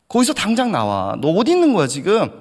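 high, loud telling-off; scolding. Retold or imitated. Older brother scolding his junior, high loud telling-off. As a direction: tell him off, demand an answer. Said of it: "제가 혼을 냈습니다".

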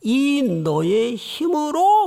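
이 너의 힘으로 drawn out slowly, each word weighted; slow stressed scripture phrase; slow emphatic quote. Shown as a demonstration. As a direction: linger on 이 너의 힘으로. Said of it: "십사 절을 다시 보시면".

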